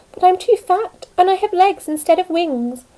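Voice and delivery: high, loud lament; wailing. Acted. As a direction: cry out in self-pity. Caterpillar laments to butterfly.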